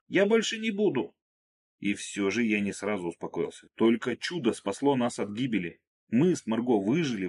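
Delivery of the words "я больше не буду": put-on high shouted girl's promise; shouting. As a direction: call it out from a distance. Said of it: "крикнула она".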